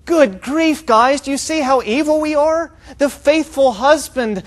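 high, loud, hammed-up dismay; mock crying out. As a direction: imitate people appalled at their own guilt. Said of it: "They're not crying out because they're going".

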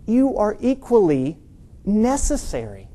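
"necessary" set apart and drawn out slowly; slow down and weigh the word "necessary"; stress on necessary. Real, not acted. Preacher telling unseen members their worth, "necessary" slow and deliberate. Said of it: "I stress the word".

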